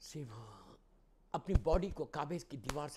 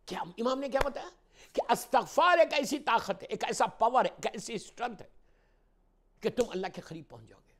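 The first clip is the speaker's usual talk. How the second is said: loud, high rhetorical build; raised pulpit voice; impassioned preaching; 10 semitones higher, 13 dB louder than usual. Real, not acted. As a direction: hammer it out to the congregation. Preaches at full force.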